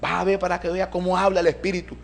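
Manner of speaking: lightly voiced helper talking up the séance; eager young helper. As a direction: pitch up, press the invitation on the listener. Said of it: "Cuando me dijo".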